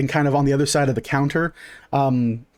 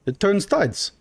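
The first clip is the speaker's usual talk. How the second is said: higher, swooping insistence; insistent marble-mouthed shopkeeper; 6 semitones higher than usual. Acted. Shopkeeper repeating his claim to doubting customer, high and insistent. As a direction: insist thickly, pitch jumping up then dropping.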